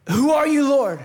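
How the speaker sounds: "Who are you, Lord?" said loud and high, with a strong push on "are"; emphatic question. Shown as a demonstration. Pastor reading Paul's question aloud, emphatic and ringing. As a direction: read it out like a ringing cry to God. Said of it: "ni måste förstå att man säger Lord".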